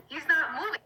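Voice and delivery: rising panic. Retold or imitated. Rider speeding at the squirrel, rising mock panic. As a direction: repeat it with the alarm building. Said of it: "I'm like".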